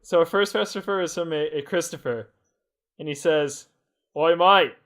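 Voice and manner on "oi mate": best attempt at an Australian accent. Reading a listener's question on the podcast, Australian accent. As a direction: put on an Aussie accent for the question. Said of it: "put on your best Australian accent".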